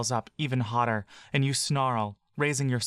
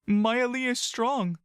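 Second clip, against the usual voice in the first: much higher, slow, pleading; desperate insistence; 11 semitones higher, slower than usual. Acted. Buck insists to Laurel that Maeli survived.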